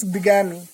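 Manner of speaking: bigamy with a short i at the start, BIG-a-mi; correct pronunciation. Shown as a demonstration. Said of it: "this is bigamy".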